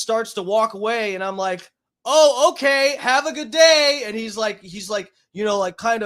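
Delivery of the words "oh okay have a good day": sarcastic farewell. Retold or imitated. Sarcastic farewell to departing man.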